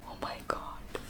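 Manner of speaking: breathless whispered swoon; awed whisper. Real, not acted. Reader swooning at a kiss scene, whispered. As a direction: whisper it like you can't handle the scene.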